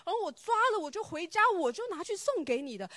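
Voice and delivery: high, loud, disbelieving; retold astonishment. Friend hearing the vegetables were rotten, shocked and loud. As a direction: gasp it out, shocked. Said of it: "你知道他非常非常讶异".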